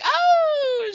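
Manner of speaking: very high, loud gasp of realization; shocked oh. Retold or imitated. Friend suddenly gets the dirty joke, shocked high oh. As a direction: hit the moment it dawns on her.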